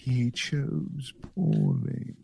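slow, quiet solemn knight; stagey fake archaic declaiming. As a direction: intone it like the ancient guardian. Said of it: "the old knight in that Indiana Jones".

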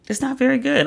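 insistent verdict. Real, not acted. Repeats the reluctant verdict.

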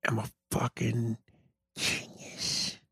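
breathy whispered boast, very soft; hushed, low self-praise. Pretend. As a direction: whisper "fucking genius" like a secret. Quiet self-praise before repeating it.

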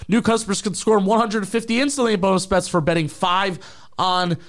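upbeat sponsor ad read. Acted. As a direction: pitch it like a radio ad. Host reads sponsor ad.

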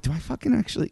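incredulous self-question. Retold or imitated. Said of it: "I'm like".

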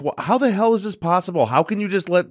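high, heated retold yell at customer service; reliving the outrage, voice up high. Yelling at the phone company rep, high-pitched angry outburst. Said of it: "Yeah, I'm yelling at him".

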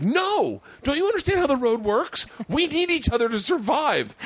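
high mock whine to a colleague; desperate pleading self. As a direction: beg her not to leave the bar. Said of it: "And I was like".

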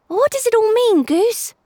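put-on a kid pressing his dog; boy's voice. A narrator's reading. Obi asks the dog.

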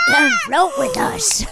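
high creepy clown. Retold or imitated. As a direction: lure them like Pennywise. Pennywise clown from It.

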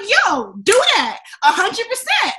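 high, hyped-up encouragement; excited urging. Retold or imitated. Friend backing Jasmine's leap into comedy, excited urging. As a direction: cheer them on, pitch jumping up. Retold cheering on friend quitting job.

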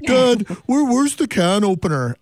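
mocking teen impression; teenager voice. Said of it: "I would typically break into my teenager voice here".

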